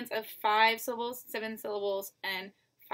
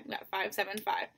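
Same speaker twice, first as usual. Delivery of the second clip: usual speaking voice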